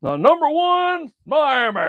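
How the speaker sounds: drawn out, high announcer call; slow, high mock announcer's call of the number one team. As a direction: boom it like a TV announcer. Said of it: "you hear that in that voice there".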